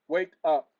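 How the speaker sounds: sudden loud call breaking off the sentence; loud wake-up call. Real, not acted. Cutting himself off to wake his phone assistant, loud call. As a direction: shout to wake the device. Repeating wake word louder.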